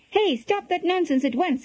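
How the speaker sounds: high-pitched scolding, volume held back; mock shout. A narrator's reading. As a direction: call out a half-voiced reprimand. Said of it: "he shouted".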